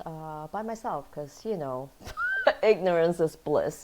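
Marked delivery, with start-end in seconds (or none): 1.9-2.6 quick high giggle; real little giggle; short high laugh. Real, not acted. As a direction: let a giggle escape. Laughs before ignorance is bliss.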